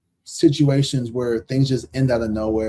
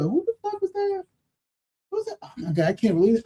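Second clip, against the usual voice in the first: much higher, airy dramatic voice; mock dramatic squeal; 14 semitones higher, 4 dB quieter than usual. Mocking people boo-hooing over an ex, in a high dramatic squeal. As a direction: ham up the heartbroken girl.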